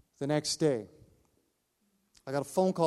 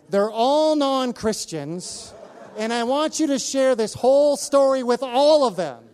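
much higher and louder, slowed; eager plea; 11 semitones higher, 9 dB louder than usual. Retold or imitated. Widow asks pastor to tell her family, excited. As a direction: urge him with excited insistence. Wife's urgent request.

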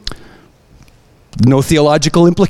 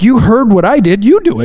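rising higher, pushing him to pray himself; insistent urging; 9 semitones higher, 3 dB louder than usual. Retold or imitated. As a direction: egg him on, pitch climbing higher.

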